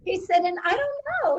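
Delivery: high, laughing through the words; retelling a funny story to a friend, laughing. Real, not acted. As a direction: giggle as you say it. Laughing after husband quote.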